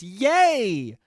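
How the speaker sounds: high, drawn-out fake "yay"; mock cheer. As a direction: cheer with overdone eagerness. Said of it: "your response is".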